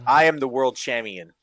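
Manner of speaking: "champion" said as cham-yun; gimmick mispronunciation. Retold or imitated. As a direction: proclaim it as the wrestler, dropping the P in "champion". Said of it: "That becomes his gimmick".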